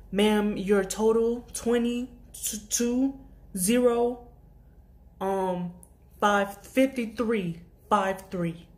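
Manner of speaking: slow, careful over-enunciation; slow enunciating. Retold or imitated. Cashier reading a total slowly, over-enunciating.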